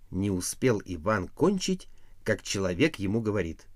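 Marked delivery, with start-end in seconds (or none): none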